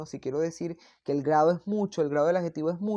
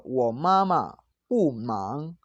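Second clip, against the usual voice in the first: very slow Mandarin with drawn-out, swooping tones; slow model pronunciation; 3 semitones lower, 4 dB louder, much slower than usual. Shown as a demonstration. Teacher giving a negative example to students, slow model pronunciation. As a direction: stretch each syllable for learners.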